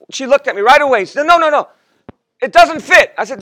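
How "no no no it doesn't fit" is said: wife's voice. Retold or imitated. Wife voiced in marriage story.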